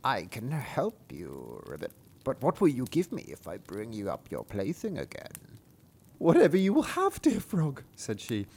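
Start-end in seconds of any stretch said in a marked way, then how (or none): 0.0-5.3 frog voice. Acted. 6.2-7.8 princess voice. Acted. Princess answering the frog, high girlish squeal.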